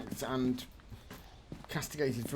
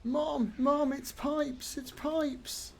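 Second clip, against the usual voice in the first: put-on very high-pitched child calling for Mom; imitated frightened child; 13 semitones higher, much slower than usual. Player quotes the Ghostwatch child, high mock-scared voice. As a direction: put on a little kid's high cry.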